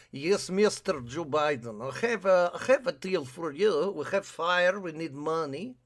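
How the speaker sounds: loud, hammed-up Russian accent in broken English; bad Russian accent. Hawaii pitching a deal to Joe Biden in a bad Russian accent. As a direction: ham a clumsy Russian accent. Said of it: "I can't do a Russian accent".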